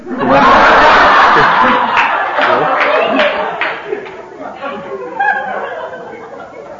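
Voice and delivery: breathy high laugh. Real, not acted. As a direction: laugh off the joke in an unvoiced giggle. Laughs at his own joke.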